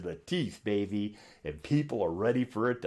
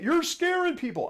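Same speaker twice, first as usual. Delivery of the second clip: high put-on voice of a scolding critic; high mocking voice of a viewer scolding the host; 4 dB louder than usual. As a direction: squeak it like a worried viewer. Voicing imagined critic.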